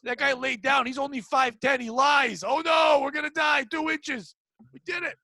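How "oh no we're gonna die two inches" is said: high, hammed-up alarm; mock panic. Mocking fear of two inches, in high fake panic. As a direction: pretend to panic over a tiny thing.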